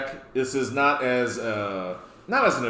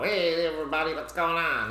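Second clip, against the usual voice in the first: higher, put-on runt greeting; runt of the crew; 5 semitones higher than usual. Little runt dude greeting the whole party, put-on higher voice. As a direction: play the little hanger-on calling to everybody. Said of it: "that little runt dude in the crew".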